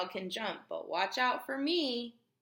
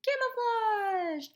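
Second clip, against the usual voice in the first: camouflaged stretched slowly and much higher; drawn out; 12 semitones higher, slower than usual. Shown as a demonstration. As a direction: hold out camouflaged as the answer reveal. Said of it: "he is".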